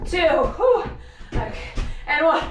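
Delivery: panting between counts; gasping through the count; out of breath. Real, not acted. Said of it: "Apparently I can't talk and do that".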